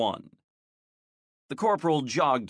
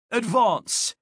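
shouted command; 9 semitones higher, 4 dB louder than usual. A narrator's reading. Drill command in a fight.

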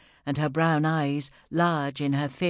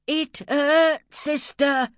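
high, loud, pained cry; high pained yell at the nun; grunting shout; 12 semitones higher, 5 dB louder, much slower than usual. Acted. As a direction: shout it through the pain. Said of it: "the grunting girl shouted again".

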